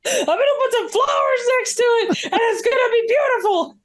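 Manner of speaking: high, gleeful hammed-up villain; over-the-top villain. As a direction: gloat like a cartoon villain. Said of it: "He doesn't actually say that".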